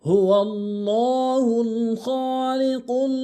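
slow, drawn-out melodic chanting; Quran recitation. Shown as a demonstration. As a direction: recite the verse in slow tajwid.

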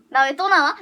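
ordinary talk

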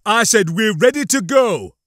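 loud, high, forceful officer's rebuke; biting. A narrator's reading. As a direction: repeat it with bite, as an impatient superior. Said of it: "putting some bite into his voice".

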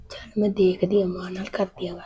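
hushed, unvoiced whisper to herself; whispered aside. Daughter whispering to herself before approaching mother, hushed whisper. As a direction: whisper it so the others won't hear.

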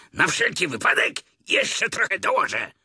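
high, loud tiger roar. A narrator's reading. Tigger grabbing extra candles, high loud roar. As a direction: bellow it out at a high pitch. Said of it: "ryknął".

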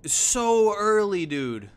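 loud, high, drawn-out exclamation, easing down at the end; excited shock. Real, not acted. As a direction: cry out in real amazement. Excited at a very early Behemoth.

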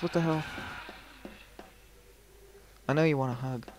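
normal talking voice

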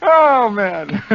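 long high cackling at own joke; helpless staccato laughter, high; real laughter. Real, not acted. Host cracks up at his own punchline, laughing hard.

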